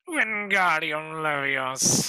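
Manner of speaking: slow drawn-out incantation; mock wand-waving spell; spell casting. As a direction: cast it like a wizard. Casting Harry Potter spell.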